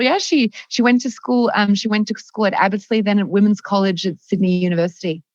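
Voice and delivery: ordinary talk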